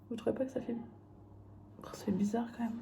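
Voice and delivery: soft whispered aside; whispering. Real, not acted. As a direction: whisper it to the camera.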